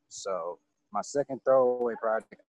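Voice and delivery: normal talking voice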